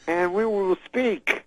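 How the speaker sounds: high, slow, rambling fragments with long pauses; Bill Cosby impression. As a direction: promise grandly in slow, high, unfinished phrases. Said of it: "so then he says".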